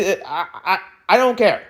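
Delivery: usual speaking voice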